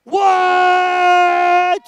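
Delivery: loud, high startled what; real surprise at the time; surprised shout. Real, not acted. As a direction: shout it in disbelief. Surprised at the timer.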